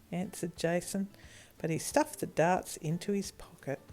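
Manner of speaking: ordinary delivery, nothing marked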